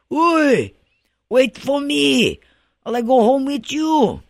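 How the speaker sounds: high, loud drawn-out call from behind; a dead man calling after him, slow and eerie; eerie ghost call. Retold or imitated. Mahikoa's voice calling after fleeing Pilikana, high eerie ghostly call. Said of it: "he hear Mahikoa's voice".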